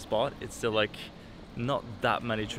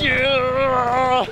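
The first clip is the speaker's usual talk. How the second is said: excited cheer; 13 semitones higher, 10 dB louder than usual. Real, not acted. Arriving at the dart spot.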